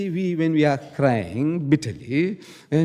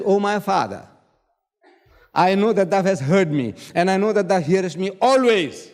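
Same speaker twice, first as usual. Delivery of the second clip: raised, declaimed prayer to the Father; praying; 3 semitones higher than usual. Acted. Jesus praying aloud before the tomb, declaimed prayer. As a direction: call up to God in formal, emphatic prayer. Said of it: "he's praying".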